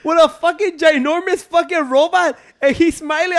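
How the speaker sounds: laughing. Real, not acted. Laughing at friend's toy robot.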